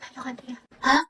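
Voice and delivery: barely audible whisper; hushed, very soft reply. Acted. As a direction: whisper secretly during a test. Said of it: "naririnig na ako nagbubulungan".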